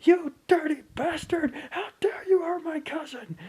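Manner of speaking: put-on high, loud shrill little-kid yell; mock child's yell. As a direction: yell it like an angry four-year-old. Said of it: "yelling something along the lines of".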